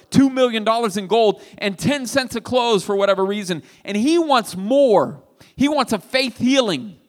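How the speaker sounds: loud, rising sermon climax; full-force preaching. Acted. Preacher presses the congregation about Naaman's pride, loud and fervent. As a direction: drive the point home to the pews.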